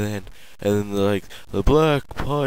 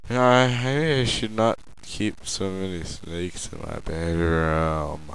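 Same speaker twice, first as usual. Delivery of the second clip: ordinary delivery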